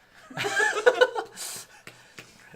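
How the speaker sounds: big high burst of laughter; loud laugh. Real, not acted. Player laughs at own misread card.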